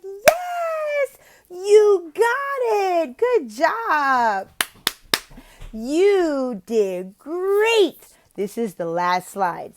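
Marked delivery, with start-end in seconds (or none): 0.0-8.0 high, loud, stretched-out cheering; excited praise. Shown as a demonstration. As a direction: celebrate a child's right answer.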